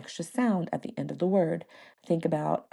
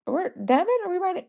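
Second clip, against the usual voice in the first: put-on confused kid; 13 semitones higher, faster than usual. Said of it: "He was like".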